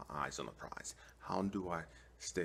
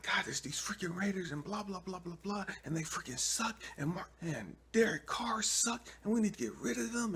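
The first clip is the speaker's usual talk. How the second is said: ranting; 4 dB louder than usual. Acted. He rants to God about Raiders.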